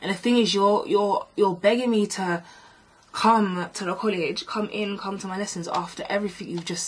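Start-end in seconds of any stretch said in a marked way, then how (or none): none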